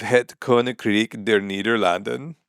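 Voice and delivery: "Het Koninkrijk der Nederlanden" sounded out slowly by syllables; semi-phonetic pronunciation. Shown as a demonstration. Mockingly letting a commenter know the name, semi-phonetic pronunciation. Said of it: "the semi-phonetic pronunciation of".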